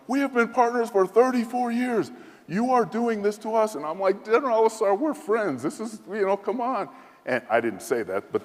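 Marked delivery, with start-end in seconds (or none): 0.1-3.7 much higher, pleading accusation; wounded general. Retold or imitated. General accusing the US envoy, higher and aggrieved. 4.2-6.9 inner plea. Retold or imitated. His own imagined plea.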